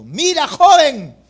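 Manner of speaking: high, loud, slow stern warning; a stern mother addressing the groom. Retold or imitated. Mother warning the groom at the wedding, loud and stern. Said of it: "mi madre ... le dijo al novio".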